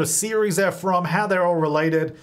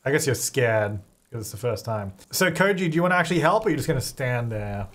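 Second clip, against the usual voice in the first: ordinary delivery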